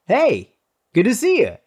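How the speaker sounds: very high, chirpy hello; gushing greeting. Acted. Big dude greeting Minnesotans, high and chirpy. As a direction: send it way up, sweetly overdone.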